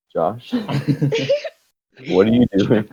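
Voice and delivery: high, laughing mock dad voice; dad catching him. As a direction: play the dad catching his kid, half laughing. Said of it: "he walks in and he's like".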